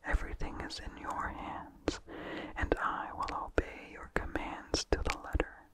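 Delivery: usual speaking voice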